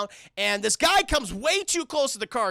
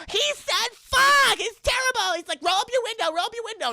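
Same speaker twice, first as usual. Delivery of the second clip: mock shrieking girl; 12 semitones higher, 4 dB louder than usual. Mocking the girls panicking at the man, high shriek. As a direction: shriek it like a scared heroine. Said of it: "And he's like".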